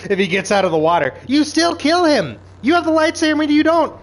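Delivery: high, loud frustrated shout; exasperated yell. Real, not acted. Yelling at Osha to kill the Sith guy, exasperated and loud. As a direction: yell it at the screen in disbelief.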